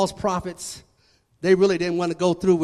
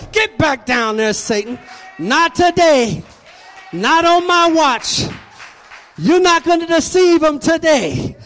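raised, forceful preacher's shout as God; God's stern command; 8 semitones higher, 9 dB louder than usual. Acted. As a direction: order the enemy down with total authority. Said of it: "God put his foot on his neck and said".

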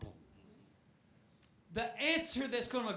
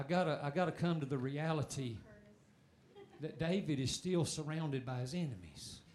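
quiet aside; 7 semitones lower, 7 dB quieter than usual. Real, not acted. Winding down, low aside.

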